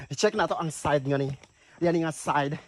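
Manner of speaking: ordinary talk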